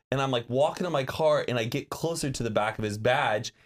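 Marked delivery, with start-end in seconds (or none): none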